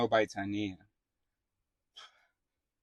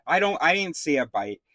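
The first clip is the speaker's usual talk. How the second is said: usual speaking voice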